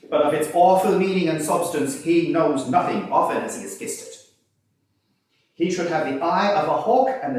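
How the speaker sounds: cross-examine with cutting, forcible flair; quaint forcible prisoner. Acted. Frank cross-examining witnesses before the judge, loud forcible pleading. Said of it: "mode of delivery were both quaint and forcible".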